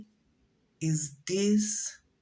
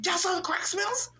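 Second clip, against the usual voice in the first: higher, rising disbelief on smells; incredulous question; 10 semitones higher than usual. Retold or imitated. The naive speaker echoes her friends in higher surprised disbelief. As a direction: ask it back in disbelief. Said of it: "I was like".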